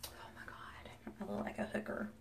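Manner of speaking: shocked whisper. Real, not acted. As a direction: whisper in horrified disbelief. Shocked at own makeup.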